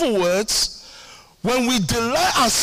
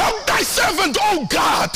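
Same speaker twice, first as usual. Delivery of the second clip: mock prayer; 7 semitones higher than usual. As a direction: call out like a pleading servant. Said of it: "I say".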